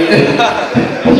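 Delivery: a quick unvoiced laugh at his own joke; breathy laugh. Real, not acted. Comic laughing at his own punchline. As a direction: let out a short breathy chuckle.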